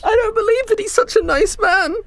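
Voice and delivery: put-on very high-pitched confused kid; small kid demanding answers; bewildered child. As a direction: plead in a high little child's voice.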